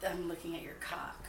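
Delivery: breathy joking whisper. Real, not acted. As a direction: whisper it with a giggle. Whispered joke.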